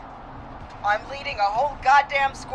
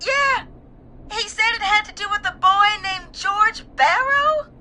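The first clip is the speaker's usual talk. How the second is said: high-pitched, upset insistence; distraught; 9 semitones higher, 4 dB louder than usual. Acted. Caller names George Barrow to host, distraught. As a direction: relay it urgently through distress. Caller recounts dying friend's words.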